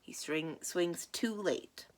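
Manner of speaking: usual speaking voice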